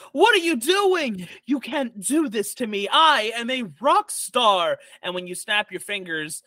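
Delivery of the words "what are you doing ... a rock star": high-pitched, outraged diva protest; hammed-up indignant diva, drawing out the boast; indignant rock star hag. Paralyzed hag protesting to her captors, indignant. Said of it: "and she goes".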